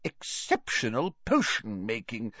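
swooping high professor, stretched endings; tipsy Slughorn, chummy. Acted. Merry host shares credit with his colleague, high and effusive. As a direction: flatter a colleague with tipsy cheer.

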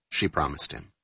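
ordinary delivery

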